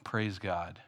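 ordinary talk

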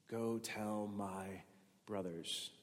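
almost breathe the words, slowly; hushed tender quote. Shown as a demonstration. Preacher savouring Jesus' words to his disciples, hushed. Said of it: "Those words".

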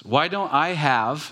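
complaining. Retold or imitated. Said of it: "mock complaint about lacking".

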